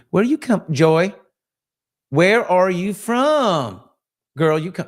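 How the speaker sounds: high, loud Southern drawl, stretched out; Southern accent. Acted. Asking listener Joy where she's from, playful Southern accent. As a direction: call out in a big Southern accent. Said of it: "Loved you assume my Southern accent".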